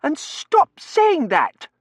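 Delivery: very high, loud, slow command; furious miss spacing out the words; quivering rage. A narrator's reading. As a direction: order it in a girlish fury. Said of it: "her ruffles quivering with rage".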